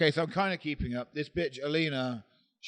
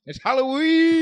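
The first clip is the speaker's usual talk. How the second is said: spooky Halloween cry; 11 semitones higher, 8 dB louder than usual. Pretend. Hyping the ghost story for listeners, spooky cry. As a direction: sing out the last word eerily.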